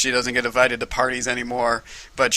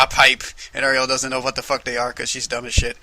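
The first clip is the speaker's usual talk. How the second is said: ordinary delivery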